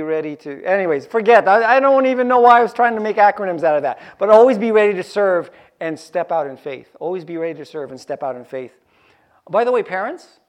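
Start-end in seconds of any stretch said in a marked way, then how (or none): 4.2-6.9 louder, driving the line home; emphatic. Shown as a demonstration. Preacher urging the congregation, loud and emphatic. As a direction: proclaim it to the whole room. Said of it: "preacher repeats the line".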